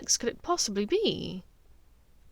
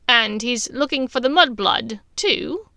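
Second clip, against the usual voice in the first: sharp; 3 semitones higher, 9 dB louder than usual. A narrator's reading. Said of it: "asked sharply".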